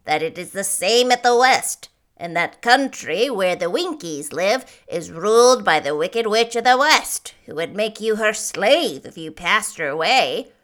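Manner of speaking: loud Munchkin man's character voice; third Munchkin. A narrator's reading. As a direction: warn loudly as a village elder.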